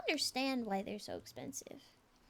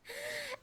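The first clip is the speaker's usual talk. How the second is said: sharp excited gasp; surprised gasp; 12 semitones higher, 6 dB quieter than usual. Real, not acted. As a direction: gasp in delight. Surprised gasp at incoming raid.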